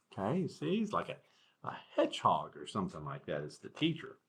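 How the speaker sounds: ordinary delivery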